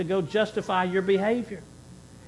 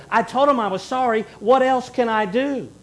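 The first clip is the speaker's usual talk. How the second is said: louder, higher, fed-up whine; mock exasperation at being asked for more; exasperated excuse; 6 semitones higher, 6 dB louder than usual. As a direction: throw up your hands in self-defence. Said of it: "you've even heard people say it this way".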